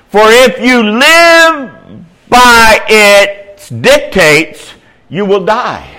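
loud, high, very slow warning; emphatic pulpit reading; preaching scripture. Acted. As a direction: proclaim each phrase with drawn-out force.

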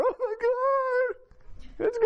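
very high, very slow stretched wail; mock bawling harder. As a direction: drag out the sob as if crying even harder. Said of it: "She's bawling even harder".

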